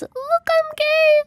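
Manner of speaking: high, drawn-out cat cry; cat imitation. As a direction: mimic a cat yowling in words. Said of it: "the cat that says".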